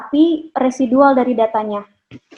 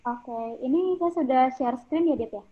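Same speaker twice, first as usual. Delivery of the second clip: much softer, faint and distant; barely audible; 10 dB quieter than usual. Real, not acted. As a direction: talk faintly, off the mic. Sound check, mic too quiet.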